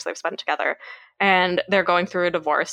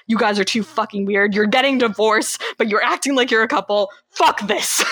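mock exasperated friend. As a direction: imitate a fed-up friend, rising into indignation. Acts out exasperated friends at dinner.

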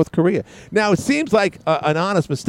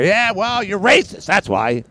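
much higher, sneering mock voice; mock liberal retort; 9 semitones higher, slower than usual. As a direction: sneer it like a smug critic.

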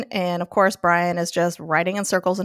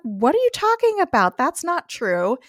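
shocked denial, pitched way up; indignant surprise; 8 semitones higher than usual. Retold or imitated. Patty protesting to Graham, high indignant surprise.

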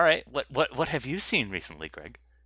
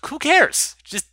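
exasperated rant; 6 dB louder than usual. Real, not acted. Ranting about military drama.

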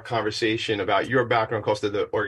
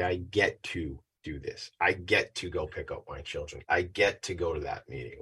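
grateful reframing; 5 semitones lower, 8 dB quieter than usual. Retold or imitated. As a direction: say it like a privilege, quietly. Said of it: "and you say".

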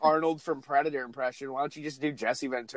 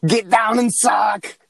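slow, loud, bad Austrian barking shout; a hammed-up Arnold command, dragged out; booming mock Terminator order; shitty Schwarzenegger impression; 6 semitones higher, 8 dB louder, much slower than usual. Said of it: "shitty Schwarzenegger impressions".

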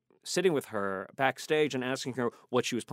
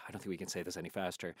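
rapid-fire; 4 semitones lower, 10 dB quieter, much faster than usual. Retold or imitated. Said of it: "you say these lines as fast as you possibly can".

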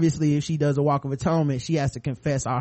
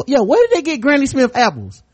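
surprised outburst; 10 semitones higher, 8 dB louder than usual. Real, not acted. Sudden excited aside about apples.

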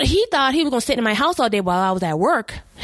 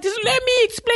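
pleading ex; 13 semitones higher than usual. Retold or imitated. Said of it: "And he's like".

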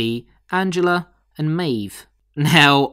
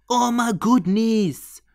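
high, very drawn-out exclamation; exclaiming in amazement; 9 semitones higher, much slower than usual. Real, not acted. Host reacting to what Lee Sizemore wears, drawn-out amazement. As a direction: stretch it out in astonishment.

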